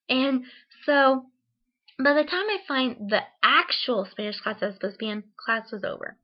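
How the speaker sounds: ordinary delivery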